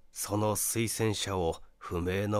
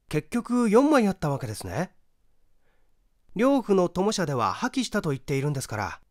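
higher, quick inquisitive male voice; raised, brisk curious young man; young questioner; 9 semitones higher, 5 dB louder, faster than usual. Acted. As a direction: probe eagerly for the answer.

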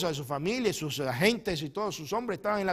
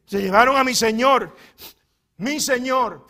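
loud, higher plea for her Lord; loud lament; 8 semitones higher, 16 dB louder, slower than usual. Acted. Mary telling the angels her Lord was taken, loud lament.

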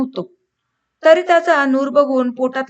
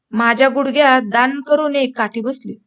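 ordinary delivery

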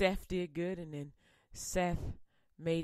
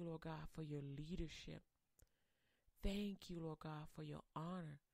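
softer, hushed prayer of thanks; closing prayer; 3 semitones lower, 6 dB quieter than usual. Acted. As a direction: pray quietly, as if alone with God. Pastor leads a closing prayer.

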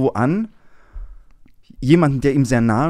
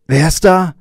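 much higher, much louder roar; bellowing; 7 semitones higher, 10 dB louder than usual. Acted. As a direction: yell it down the phone like an angry old father. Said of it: "brüllt sein Vater".

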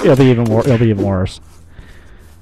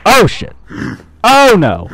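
high, loud cries of alarm; alarmed shouts; 11 semitones higher, 5 dB louder, slower than usual. Real, not acted. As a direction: yelp in panic at the game. Player in trouble mid-game.

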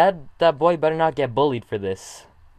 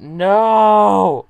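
dismayed shout; 4 semitones higher, 7 dB louder than usual. Real, not acted. Sad turn in the cartoon.

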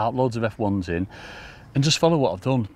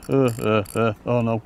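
usual speaking voice

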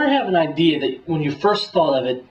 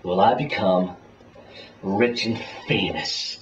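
low dramatic echo; 9 semitones lower, 5 dB quieter, slower than usual. Acted.

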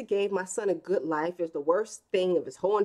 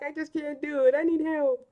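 put-on higher whiny boy voice, lightly done; helpless little son; 7 semitones higher than usual. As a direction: play the son who won't zip his own pants. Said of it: "He's like".

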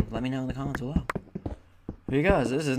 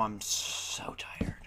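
tired whisper; 8 dB quieter, much slower than usual. Real, not acted. Tired after early game.

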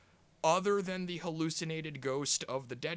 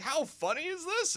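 mocking movie voice; 13 semitones higher, 3 dB louder than usual.

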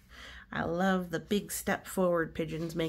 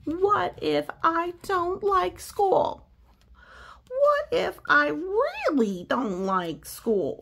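higher, louder, slow worried pigeon; anxious pigeon; 6 semitones higher, 7 dB louder, slower than usual. Acted. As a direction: spiral up into panic.

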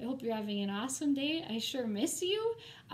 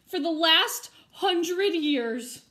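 overjoyed ghost; 6 semitones higher, 10 dB louder, much slower than usual. Acted.

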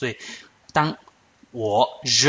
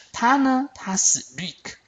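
ordinary delivery

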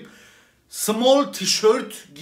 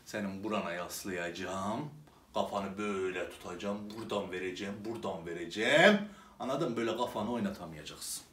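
hushed mock threat; 10 semitones lower, 12 dB quieter, faster than usual.

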